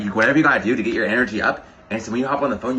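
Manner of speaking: ordinary delivery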